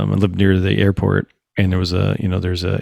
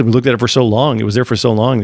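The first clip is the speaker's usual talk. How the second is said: usual speaking voice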